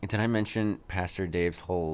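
ordinary delivery

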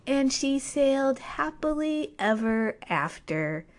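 slow and sweet storybook ending; fairy-tale ending. Shown as a demonstration. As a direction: read it like the last line of a bedtime story. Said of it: "if this was a fairy tale".

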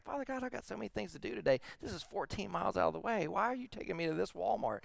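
high, fast, exasperated plea; light imitation of a fretting older lady; voiced friend. Pastor voices friend's complaint to God.

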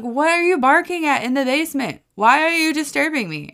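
loud, high-pitched mock telling-off; exasperated scolding. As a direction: demand answers from a naughty dog. Retold scolding of the dog.

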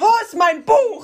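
laut und hoch, lachend vor Schreck; panischer Schrei. Real, not acted. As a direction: schreit in echter Panik nach dem Buch. Spinne entdeckt, Panik. (erschrockener Aufschrei)

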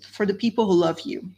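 ordinary talk, nothing marked

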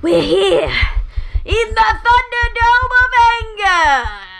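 big dramatic announcer boom; slow booming arena announcement. Acted. Announcing podcast title dramatically.

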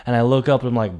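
ordinary delivery, nothing marked

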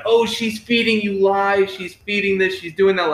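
mock accusatory listing; mock accusing. Mimics dad's side during family argument.

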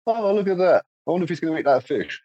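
amazed onlooker. Retold or imitated. Imagined diver marvelling at fish.

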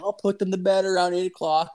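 high-pitched mock-innocent explanation; imitating his buddy. Buddy explains his evening drinking routine, imitated innocence. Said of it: "He's like".

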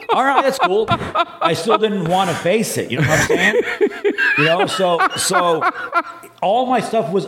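words through high laughter; high laughing disbelief retelling the doctor visit. Real, not acted. Laughing while retelling reply.

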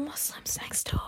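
slow eerie whisper; breathy spooky podcast whisper. Acted. Spooky whispered show intro.

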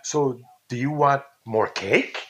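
slow, drawn-out question, CAKE lifting at the end; questioning inflection. Retold or imitated. Caller giving an example of political inflection, questioning rise on CAKE. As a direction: slowly ask it, voice rising on "cake". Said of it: "when you say it that way".

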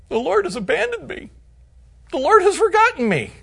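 high, soft self-pitying whine; mock whining Zion, pitched high and soft; whiny complaint. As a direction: whimper it like a sulking child.

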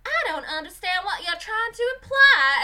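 indignant mock American Southern lady; Southern accent. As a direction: imitate an affronted Southern constituent, loudly. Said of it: "Southern constituents".

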